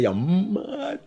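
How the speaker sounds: expected insult. Retold or imitated. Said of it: "I expect you to say".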